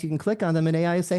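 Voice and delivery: ordinary talk, nothing marked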